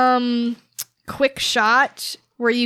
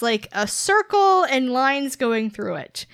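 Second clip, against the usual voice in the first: normal talking voice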